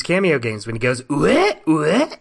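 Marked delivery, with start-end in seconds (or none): 1.1-2.1 short rising ape grunts; Donkey Kong. Acted. Co-host showing the nineties game sound, imitated ape grunts. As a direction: imitate a video game ape's questioning hoots. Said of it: "you're trying to be Donkey Kong".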